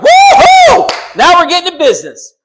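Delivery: high, loud whoop of delight; excited whoop. Retold or imitated. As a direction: whoop it out like a man finally let in on the action. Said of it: "Abram was so excited".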